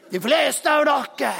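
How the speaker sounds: loud, high preaching warning; dramatic warning. Acted. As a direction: proclaim it like doom. Preacher dramatizes sinking lifeboat. (høy, dramatisk advarsel)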